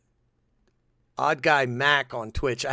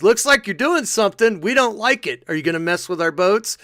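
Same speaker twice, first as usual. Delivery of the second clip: loud, higher-pitched mock accusation; imitating the US; 8 semitones higher, 8 dB louder than usual. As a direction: confront a rival nation, comically suspicious. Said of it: "goes back to U S. He goes".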